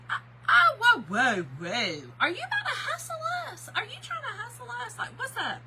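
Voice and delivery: high, laughing mock alarm; mock shock. Teasing a stranger with fancy bowling bags, high laughing mock alarm. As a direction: gasp it out, half laughing, like you've spotted a hustler.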